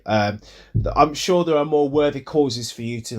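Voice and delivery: put-on low-pitched street accent; street talk. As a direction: talk a paladin down in casual street slang. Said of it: "kind of street talk".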